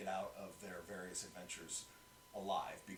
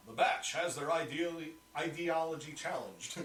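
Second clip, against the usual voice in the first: propaganda broadcaster; 5 semitones higher, 6 dB louder than usual. Shown as a demonstration. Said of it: "my Imperial propaganda, or Republic propaganda voice".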